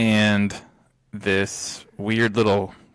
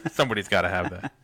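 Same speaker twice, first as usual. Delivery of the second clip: ordinary delivery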